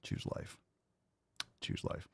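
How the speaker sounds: breathy, fading whispered repeat. Acted. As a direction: whisper it like a secret mantra.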